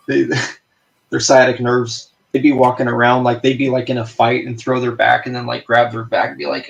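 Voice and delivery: ordinary delivery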